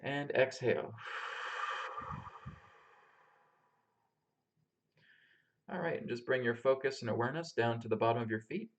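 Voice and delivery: calm, unhurried meditation guide; grounding meditation. Acted. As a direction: lead them gently through the breath and body. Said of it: "we're gonna start off with a grounding meditation".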